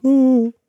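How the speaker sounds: high hooting "ooh"; imitated rolling "ooh". Game master voicing a skull rolling past, high hooting noise. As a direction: hoot it high like a passing object. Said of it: "It's like".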